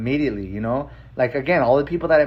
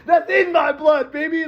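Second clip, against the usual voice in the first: much higher, slow, laughing through it; laughing with pride; 3 dB louder than usual. Real, not acted. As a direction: laugh it out, delighted. Proud and laughing.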